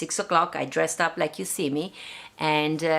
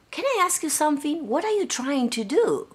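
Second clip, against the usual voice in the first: lightly voiced curious stranger; 10 semitones higher than usual.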